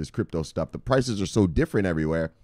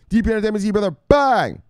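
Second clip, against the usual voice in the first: hype shoutout; 11 semitones higher, 5 dB louder, faster than usual. Acted. Shoutout to viewer.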